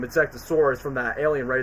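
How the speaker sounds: ordinary talk, nothing marked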